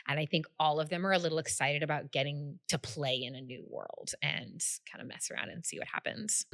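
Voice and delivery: ordinary talk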